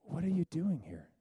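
softly voiced God questioning the prophet; still small voice. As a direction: speak as God, gently and quietly. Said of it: "the still small voice of God".

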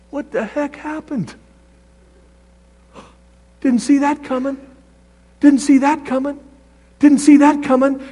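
stunned, as if just hit; dazed surprise. Pretend. Preacher acting a believer stunned by the crash, high and bewildered.

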